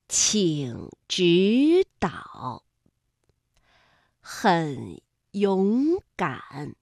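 请指导, 很勇敢 slowly, middle syllable rising; drawn-out 请指导, 很勇敢 as tones three-two-three; tone sandhi demo. Shown as a demonstration. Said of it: "是中间那个字变成了二声".

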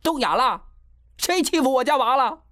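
very high, furious mother's demand. A narrator's reading. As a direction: pitch it right up and challenge everyone. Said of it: "郑阳娇的声调更高了".

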